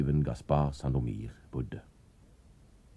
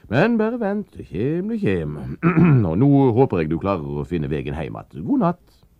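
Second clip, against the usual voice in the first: character voice; 8 semitones higher, 9 dB louder than usual. Acted. Gaspar dismisses Anders at the door.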